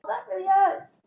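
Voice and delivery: pained surprise. Retold or imitated. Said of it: "And I was like".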